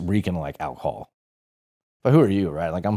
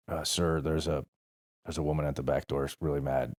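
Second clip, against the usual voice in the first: timid report; 3 semitones lower, 7 dB quieter than usual. Retold or imitated. Recruit timidly reporting to instructor.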